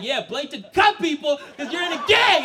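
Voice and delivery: high, loud rant; yelled accusation. Acted. Dad accusing his son over the knife, yelled accusation. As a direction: shout it as proof of guilt.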